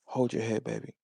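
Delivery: lightly imitated smooth-talker, a little low; mock his soft sweet-talk; hint of a put-on cool voice; Mickey's phone voice.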